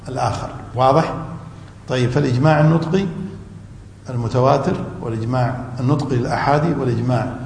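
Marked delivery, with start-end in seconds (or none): none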